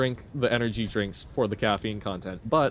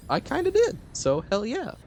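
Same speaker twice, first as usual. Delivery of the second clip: excited; 12 semitones higher than usual. Real, not acted. Proud of doing the recording.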